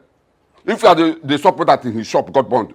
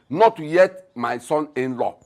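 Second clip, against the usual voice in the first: normal talking voice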